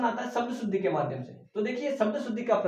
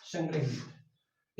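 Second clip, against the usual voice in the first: drawn out answer; 5 semitones lower, 6 dB quieter, much slower than usual. Shown as a demonstration. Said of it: "तो क्या हो जाएगा".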